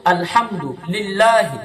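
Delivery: slow, with the হা of আলহামদু from the throat; slow recitation. Shown as a demonstration. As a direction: recite আলহামদু লিল্লাহি slowly, leaning on লিল্লাহি. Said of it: "যেখানে বড় হা সেখানে বড় হা করুন". (ধীরে আলহামদু লিল্লাহি)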